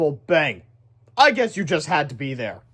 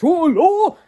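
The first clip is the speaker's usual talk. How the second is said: high, loud hero shout; triumphant cry; 12 semitones higher, 6 dB louder than usual. Acted. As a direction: soar off with a heroic yell. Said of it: "with a triumphant".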